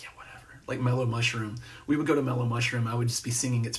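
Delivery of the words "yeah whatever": very quiet, voiceless, dismissive imitation; dismissive eye-roll. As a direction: brush it off under your breath, imitating a friend. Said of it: "always roll his eyes".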